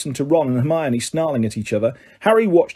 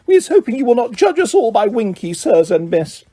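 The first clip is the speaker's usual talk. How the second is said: higher, apologetic house-elf voice; apologize, head hanging; high sorry house-elf voice; ashamed elf squeak; 7 semitones higher than usual. A narrator's reading. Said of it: "squeaked a nearby elf".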